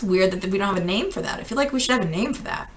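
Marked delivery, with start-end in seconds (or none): none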